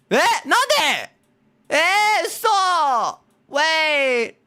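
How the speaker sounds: very high, loud squeals; flustered high-pitched screaming; panicked yelling. Real, not acted. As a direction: shriek for it to stop. Panicking at game.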